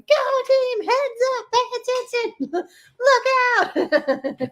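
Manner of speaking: high, loud sideline mom cheering; mommy voice. Retold or imitated. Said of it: "The mommy voice comes out of me".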